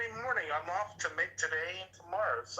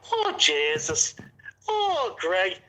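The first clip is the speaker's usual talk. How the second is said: put-on loud, drunken Irish rant; a shouting Irish uncle telling someone off; Irish accent; 4 semitones higher, 7 dB louder than usual. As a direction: boom it out in a thick Irish accent. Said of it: "I do the Irish accent".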